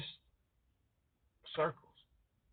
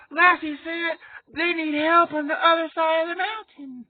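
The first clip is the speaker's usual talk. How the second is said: put-on high, loud earnest kid voice; earnest kid actor voice; 13 semitones higher, 9 dB louder than usual. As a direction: announce it like a TV child actor. TV kid relaying the dog.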